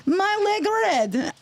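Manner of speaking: put-on high-pitched broken English, Russian accent; fake Russian accent. Russian patient telling the ER worker the complaint, mock Russian accent. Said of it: "speak English with a fake Russian accent".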